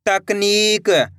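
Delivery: तकनीक very slow, tak-neee-k; sounded out slowly. Shown as a demonstration. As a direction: stretch every part of तकनीक. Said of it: "एक अक्षर का उच्चारण करके".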